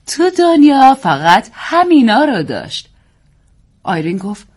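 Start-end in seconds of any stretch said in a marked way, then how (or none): 0.1-2.9 put-on slow, higher, louder English-accented reading; nanny continuing the poem in an English accent. A narrator's reading. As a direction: keep reciting slowly in an English accent. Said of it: "صدای انگلیسی ادامه داد".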